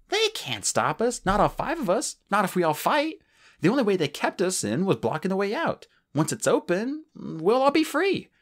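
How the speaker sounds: rally them to fight; Tsunami rallying. Acted. Tsunami rallying, voiced high.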